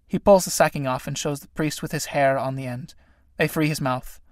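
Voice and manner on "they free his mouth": ordinary talk, nothing marked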